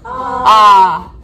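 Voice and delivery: "ah" held long and loud; isolated second sound. Shown as a demonstration. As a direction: open out a long "ah" on its own. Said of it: "Second sound".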